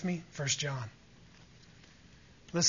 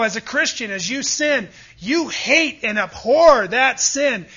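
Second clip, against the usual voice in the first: preaching at full force; 6 semitones higher, 9 dB louder than usual. Acted. Preacher to congregation about hating sin, loud fervent climax. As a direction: thunder it out against sin.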